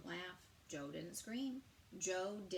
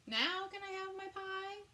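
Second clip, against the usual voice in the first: put-on much higher child voice; little possum voice; 13 semitones higher than usual. Joe thanking the bear then asking Mom for pie. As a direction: chirp it like a small eager possum. Joe's high voice.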